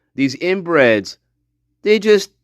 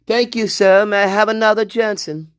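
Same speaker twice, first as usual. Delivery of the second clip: mock quote; 6 semitones higher than usual. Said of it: "No, it should be Braden".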